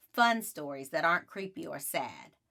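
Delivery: plain reading voice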